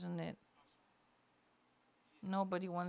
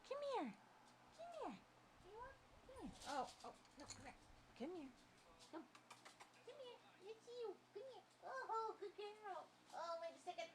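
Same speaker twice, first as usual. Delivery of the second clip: high soft pet talk; 14 semitones higher, 13 dB quieter than usual. Real, not acted. Coaxing her dog over, in soft high baby talk. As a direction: coo at the dog, pitched up and hushed.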